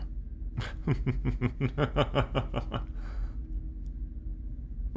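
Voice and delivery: soft breathy villain chuckle; smug chuckle. Acted. Alien captor gloating at captive, smug chuckle.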